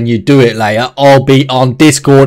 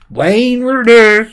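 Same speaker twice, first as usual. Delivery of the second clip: silly voice; 9 semitones higher, much slower than usual. Pretend. Silly voice bit on stream.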